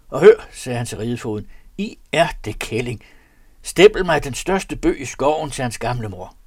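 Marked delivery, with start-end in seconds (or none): 0.1-0.5 sharp loud call; loud, higher summons. A narrator's reading. Master calls the groom. 1.8-6.4 loud berating; louder, contemptuous rebuke; angry master. A narrator's reading.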